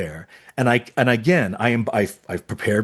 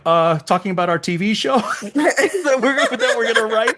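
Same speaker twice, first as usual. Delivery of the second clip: high, giggling excuse; sheepish laughing; 9 semitones higher than usual. Retold or imitated.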